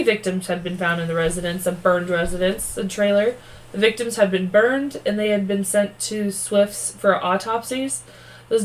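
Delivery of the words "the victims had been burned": ordinary delivery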